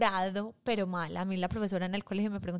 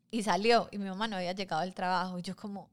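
ordinary delivery

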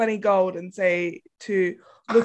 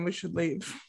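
quiet warning; 3 semitones lower, 11 dB quieter than usual. Retold or imitated. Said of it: "I say to everyone".

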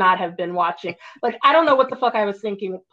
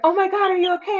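worried coworkers; 8 semitones higher, faster than usual. Retold or imitated. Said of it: "everybody was like".

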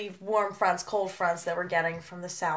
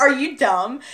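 mock scornful retort; 5 semitones higher, 7 dB louder than usual. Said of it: "I was like".

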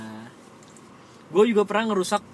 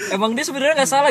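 ordinary delivery

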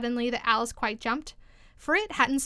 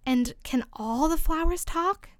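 slow, higher timid question; a small, hesitant voice raised in pitch; 5 semitones higher, slower than usual. A narrator's reading. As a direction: ask it timidly, drawing it out. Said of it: "in a timid voice, almost in a whisper".

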